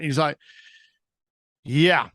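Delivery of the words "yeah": deflated retold yeah; imitating a dejected caller; depressed sigh. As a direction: let it out like a sigh. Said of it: "Just the sigh, just the depression".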